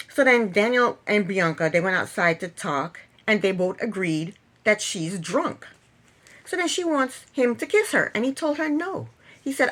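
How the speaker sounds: ordinary delivery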